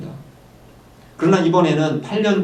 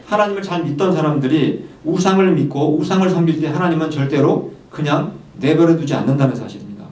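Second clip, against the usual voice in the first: usual speaking voice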